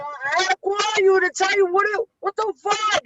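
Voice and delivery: high-pitched mock New Yorker; Carmine's New York accent. Retelling a friend's frantic message, high New York accent. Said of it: "like in the New York".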